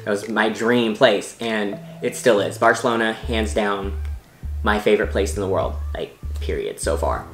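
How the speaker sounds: ordinary delivery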